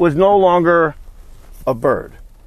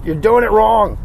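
mock scolding; 5 semitones higher, 5 dB louder than usual. Repeated louder as Fonzie jacket one.

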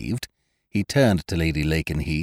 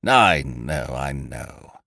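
bedside manner; 5 semitones lower, much slower than usual. A narrator's reading. Ukridge comforting the worried hostess, murmuring soothingly. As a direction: soothe her like a doctor at the bedside. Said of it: "murmured Ukridge, in a positively bedside manner".

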